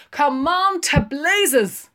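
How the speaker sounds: loud, slow, exasperated; slow loud come on; impatient. A narrator's reading. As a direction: drag him along. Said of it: "said Blazes Boylan".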